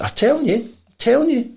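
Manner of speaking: high-pitched, repeated insistence; insistent protest. Retold or imitated. Storyteller back at the pub convincing disbelieving friends, high insistence. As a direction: protest it to doubters, pitched well up.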